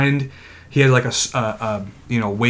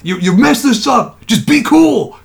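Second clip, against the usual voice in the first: raised, high hypothetical telling-off; mock angry scolding; 8 semitones higher, 4 dB louder than usual. Staffer clearing fans from the fence, mock angry. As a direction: build to a loud, exasperated order.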